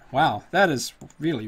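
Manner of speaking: ordinary delivery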